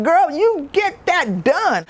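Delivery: slow, high sassy cheer; egging her friend on, drawn out; cheering sass. Retold or imitated. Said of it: "I was like".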